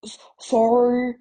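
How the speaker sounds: slow, loud stammered sob; sobbing. A narrator's reading. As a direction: choke out the apology. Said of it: "sobbed Hagrid".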